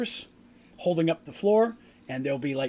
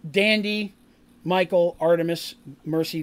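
ordinary talk

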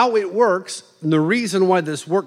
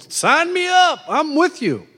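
eager volunteer; 11 semitones higher, slower than usual. Pretend. Others of you going sign me up, eager. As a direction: jump at the chance, high and bright.

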